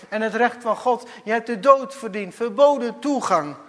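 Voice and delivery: loud, high, urgent preaching; preaching at full force. Acted. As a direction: thunder it at the congregation. (luid en dringend preken)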